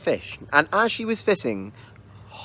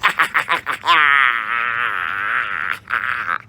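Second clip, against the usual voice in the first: low, gloating monster laugh; a drawn-out cackle of triumph; evil laugh; 4 semitones lower than usual. A narrator's reading. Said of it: "And the kelpie said".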